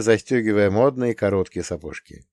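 usual speaking voice

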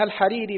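الحريري as al-ḥarīrī, pharyngeal ح; undotted ḥa. Shown as a demonstration. Student reading names to his teacher, الحريري with ḥ. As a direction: begin the name in الحريري with breathy ḥ. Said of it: "بالحاء المهملة".

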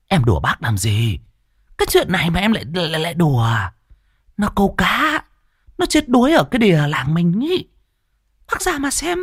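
high pitched village woman, insistent; shrill pleading woman. Acted. Mai begs old Cẩm to come see, high pleading woman.

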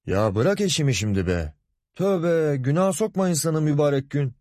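irritated grumble, climbing high in pitch; sinirli söylenme. A narrator's reading. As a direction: grumble in exasperation, voice rising. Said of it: "Sinirli odasına doğru hareketlenirken söylendi". (sinirli sinirli söylenerek)

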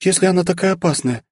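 put-on high, innocent girlish voice; naive elf girl. A narrator's reading. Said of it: "раздался наивный голосок эльфийки".

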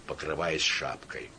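plain reading voice

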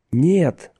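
high, restrained cry of protest; narrated cry. A narrator's reading. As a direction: cry out in protest, without full volume. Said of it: "закричал Гэрроти". (сдержанный высокий выкрик)

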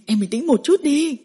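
firm call to snap her out of it; loud. Acted. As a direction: raise your voice to calm her. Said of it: "Anh nói to".

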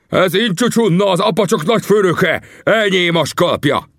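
high, sustained victory yell; triumphant roar. A narrator's reading. Tangua claims his fallen enemy's scalp, high triumphant yell. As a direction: cry out in savage triumph, pitched far up. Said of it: "diadalmas ordítást hallottam".

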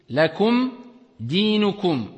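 lakum with a clean short mim, then dinukum; lakum, then dinukum, said apart. Shown as a demonstration. Teacher isolates the words to show the mim. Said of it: "on prononce le mim clairement".

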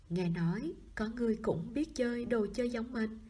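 usual speaking voice

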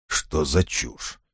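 slow, soft grumble under breath; irritated mutter. A narrator's reading. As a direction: scoff quietly to yourself. Poet mutters irritated aside.

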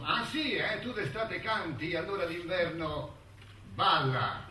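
high, taunting retort ending in a loud punchline; mocking ant. Ant answers the cicada who sang all summer, mocking. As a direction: feign interest, then land the last word hard.

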